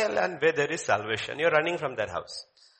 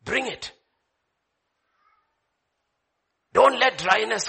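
forceful preaching; 4 semitones higher than usual. Real, not acted. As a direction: preach it hard to the congregation.

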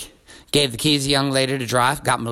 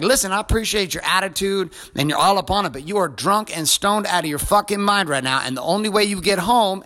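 exasperated dressing-down, higher pitched; retold angry lecture; 7 semitones higher than usual. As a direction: re-enact telling off a drunk teenager. Acted lecture to drunk teenager.

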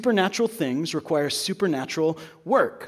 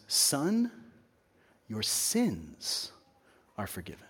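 soft, tender, falling to near silence; Jesus forgiving the paralytic, softly and tenderly; tender hushed Jesus; 5 semitones lower, 8 dB quieter, slower than usual. Acted. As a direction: speak gently to the man lying before you. Jesus speaks to paralytic man.

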